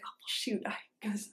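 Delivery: panicked inner whisper. Retold or imitated. Thinking to herself after the mishap, whispering. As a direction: whisper it to yourself in dismay.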